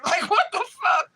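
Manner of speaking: high-pitched, incredulous shout; appalled outburst. Real, not acted. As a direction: exclaim it high in sheer disbelief. Reliving disgust at the customer.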